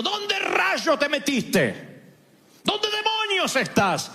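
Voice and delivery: high, slow mock-angry yell; hammed-up furious parent calling out; angry God. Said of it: "uno puede interpretar que Dios está diciendo".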